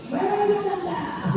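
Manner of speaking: squeaky high mock tantrum with a laugh; mock angry bird. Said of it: "앵그리 버드가 된 거예요". (앵그리 버드처럼 높고 장난스러운 짜증)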